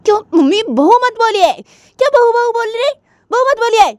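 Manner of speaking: annoyed daughter. Acted. Jiya to her mother, angrily objecting. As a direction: stop her mother saying it.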